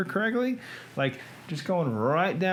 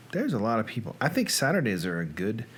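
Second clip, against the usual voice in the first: usual speaking voice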